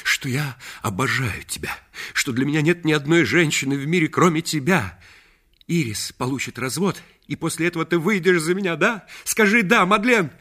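громче и выше, всё быстрее и настойчивее; умоляет ответить «да», нарастая; страстное признание. A narrator's reading. (Реджи требует от Мадлен согласия, громко и горячо)

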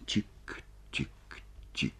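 soft, evenly spaced ticks; quiet, detached clockwork syllables; ticking clock. A narrator's reading. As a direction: tick slowly like a wall clock. Imitates the ticking wall clock.

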